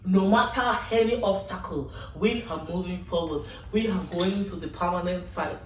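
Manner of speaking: ordinary delivery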